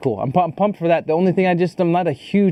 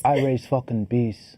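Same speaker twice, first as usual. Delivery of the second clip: put-on understated British hard-man accent; 2 semitones lower, 4 dB quieter than usual. Beekeeper action hero warning a scammer, British accent. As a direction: state it like a British tough guy. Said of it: "Good job on your British accent".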